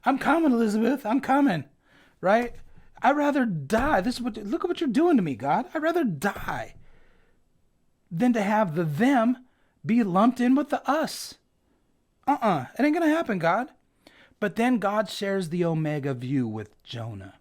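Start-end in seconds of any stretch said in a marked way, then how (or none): 0.0-1.6 quick, higher, hammed-up fake heart attack cry; lightly voiced Fred Sanford clutching his chest; Sanford and Son bit. Preacher compares Jonah to Sanford and Son, mock dying cry. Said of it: "Sanford and Son, right?". 3.0-13.7 a sulky prophet refusing, mildly overplayed; Jonah's tantrum. Jonah tells God he'd rather die, mock indignant.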